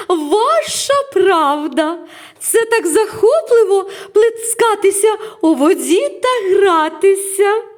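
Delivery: giggling, very high, loud character voice; laugh, then exclaim slowly with high glee; loud high-pitched joy, drawing words out; delighted exclamation. A narrator's reading. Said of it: "вигукнула Келлі".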